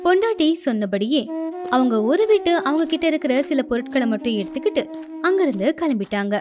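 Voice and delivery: ordinary delivery, nothing marked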